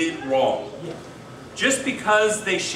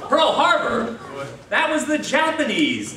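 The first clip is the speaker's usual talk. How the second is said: high, louder mock outrage; indignant disbelief; 7 semitones higher, 3 dB louder than usual. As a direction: protest the mistake in astonishment. Joke figure objects to accusation.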